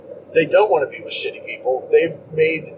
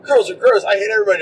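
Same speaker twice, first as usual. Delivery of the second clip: put-on higher, louder whiny little boy; mock kid voice; 6 semitones higher, 5 dB louder, much faster than usual. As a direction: play the grossed-out young boy. Boy's younger self voiced.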